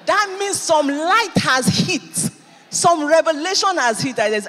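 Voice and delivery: rising sermon declaration; full-force preaching. Acted. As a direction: preach it out over the music.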